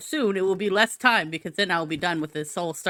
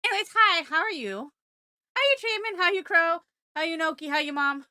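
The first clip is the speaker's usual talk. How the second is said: high excited greeting; 8 semitones higher than usual. Real, not acted. Greeting chat members.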